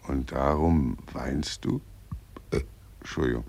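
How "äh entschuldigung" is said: softer, Entschuldigung said through a burp; a muffled belch on Entschuldigung; burping apology. Acted. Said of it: "rülpsen Sie denn fortwährend so ekelhaft".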